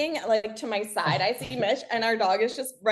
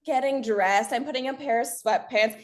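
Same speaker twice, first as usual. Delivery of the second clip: normal talking voice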